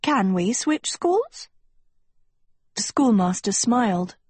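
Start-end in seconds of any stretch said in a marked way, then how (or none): 0.0-1.5 high, slow, hopeful question; sweetly eager high-pitched request; brightly hopeful. Acted. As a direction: ask brightly, pitched right up. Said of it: "Sophie looked up brightly".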